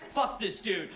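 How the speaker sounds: normal talking voice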